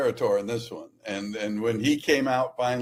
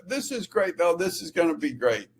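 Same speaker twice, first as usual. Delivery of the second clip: delighted league official; 9 semitones higher than usual. Retold or imitated. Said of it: "the league calls me on Monday and says".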